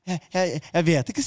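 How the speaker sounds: mildly nervous retold answer; nervous stammer. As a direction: stumble into it, acting out nerves. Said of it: "jeg blir så nervøs".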